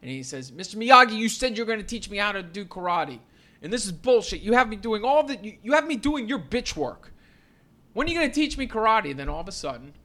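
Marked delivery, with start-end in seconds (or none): 0.5-9.1 higher, much louder outraged rant; Daniel freaking out. Retold or imitated. Daniel yelling at Miyagi about the chores, loud and high. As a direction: vent at the teacher in full frustration. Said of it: "Daniel-san freaks out".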